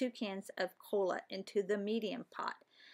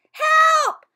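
very high, very loud squawking parrot; a shrieking parrot crying for help; parrot yelling; 17 dB louder, slower than usual. Acted. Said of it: "yelled the parrot".

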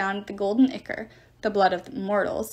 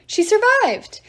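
very high, loud joyful exclaim; overjoyed shout of relief; thrilled loud exclamation; excited cry; 11 semitones higher, 6 dB louder, slower than usual. A narrator's reading. Hazel spots survivor.

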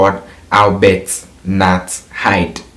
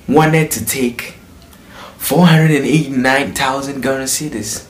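dramatic reveal; 4 semitones higher than usual. Real, not acted. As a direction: stretch the number out in shock. Dramatic reveal of huge fee.

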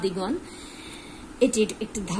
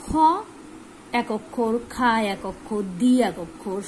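normal talking voice

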